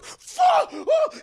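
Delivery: very high, loud yelp; hammed-up terrified cursing; panicked shriek. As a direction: scream as if yanked by the hair. Friend's hair pulled by spirit.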